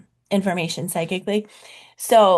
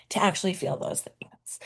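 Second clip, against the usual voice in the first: whispered, softer ending; hushed; 6 dB quieter than usual. Real, not acted. Coach confiding the payoff to her viewers, whispered. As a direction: let it fall to a near whisper. Drops into hush at sentence end.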